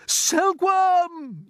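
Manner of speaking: very high, loud drawn-out call; boy's urgent shout; yell. A narrator's reading. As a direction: yell a name in an emergency. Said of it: "yelled James".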